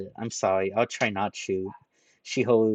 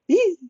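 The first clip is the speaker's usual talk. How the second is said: shrill high squeal of fright; frightened yelp; 11 dB louder than usual. Acted. Ranta terrified by the gangster, a squealing yelp. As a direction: yelp like a kicked dog.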